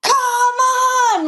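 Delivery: high, drawn-out mock holler; calling out in protest. Jockey protesting to a rider cutting her off, high drawn-out holler. Yelling at rider who cuts in.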